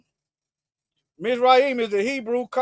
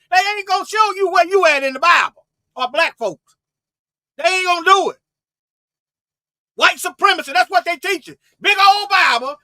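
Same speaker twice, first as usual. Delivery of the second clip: fiery preaching shout; 6 semitones higher, 4 dB louder than usual. Real, not acted. Preacher denouncing hidden Bible truth.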